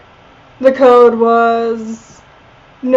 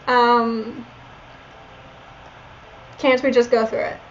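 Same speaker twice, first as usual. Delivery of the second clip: put-on Valley Girl voice; 5 dB quieter than usual. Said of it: "my Valley Girl voice".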